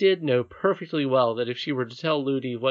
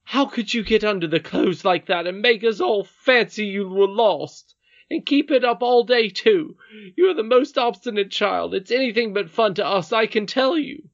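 loud, high nurse's tirade; angry scolding; 9 semitones higher, 7 dB louder than usual. Acted. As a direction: scold the child in exasperation.

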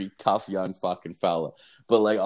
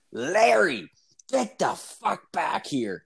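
put-on higher, slow, stretched-out yell of an old wife; shrill wife calling; 8 semitones higher, much slower than usual. Wife yelling at her husband from the minivan, higher and slow. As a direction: call him back like a fed-up grandma.

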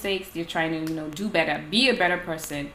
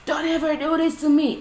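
high-pitched mock yell, only slightly louder; retold yell; 8 semitones higher, 3 dB louder than usual. Fed-up woman retelling her outburst, mock yell. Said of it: "I yell".

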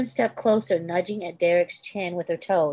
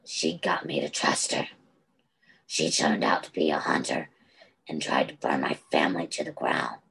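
much lower grim confession; deep male voice; 14 semitones lower than usual. Acted. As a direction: drop into a low, heavy man's voice.

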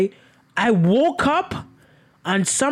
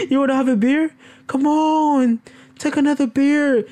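mock creepy host; 9 semitones higher than usual. Pretending to be Dahmer offering a guest beer, higher hammed-up voice. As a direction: push a beer on a guest in a put-on voice.

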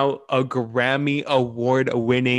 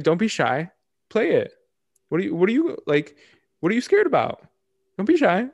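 high-pitched mock coaxing; teasing challenge; 8 semitones higher, 3 dB louder, faster than usual. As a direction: goad them in a high, teasing voice. Taunting country stations to play song.

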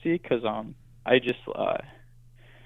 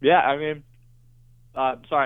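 mouth full; 3 semitones higher, 6 dB louder than usual. Real, not acted. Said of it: "I'm eating Oreo right now".

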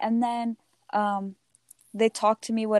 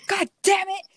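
angry curse; 9 semitones higher than usual. Retold or imitated. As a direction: swear it out like a furious mom. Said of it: "she was so angry".

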